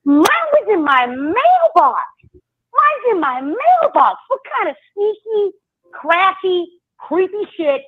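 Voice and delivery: high-pitched, drawn-out outrage; indignant disbelief. Real, not acted. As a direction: stretch it out in high, exasperated disbelief. Indignant rant about ants in her mailbox.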